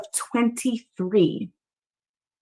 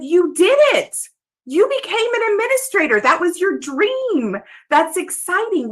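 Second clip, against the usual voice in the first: higher, fast and thrilled; excited former pupil; 7 semitones higher than usual. Retold or imitated. Student congratulating teacher on her promotion, excited and high. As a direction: cheer her on like a proud kid.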